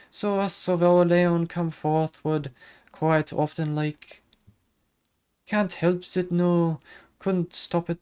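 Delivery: high lilting Irish fairy voice; Irish accent. Acted. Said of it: "let's hope I can remember how to do the Irish accent".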